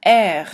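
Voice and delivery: R hacked up from the throat; throaty French R, spat out. Shown as a demonstration. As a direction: hock the R like clearing your throat. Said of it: "like you're hucking a loogie".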